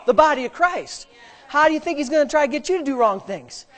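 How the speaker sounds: usual speaking voice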